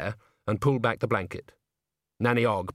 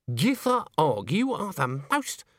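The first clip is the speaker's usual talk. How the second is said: slow, higher-pitched indignation; scandalized scolding; 5 semitones higher, slower than usual. A narrator's reading. Witch scolds her friend for a saucy remark, scandalized. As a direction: draw out the reproach, pitch climbing. Granny scolds Nanny, cut off.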